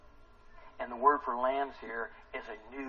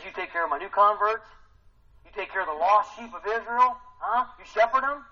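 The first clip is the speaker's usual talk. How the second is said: higher, louder, building charge; urgent charge; 8 semitones higher, 7 dB louder than usual. Acted. Jesus charging Peter to shepherd the converts, urgently. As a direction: press the command harder each time.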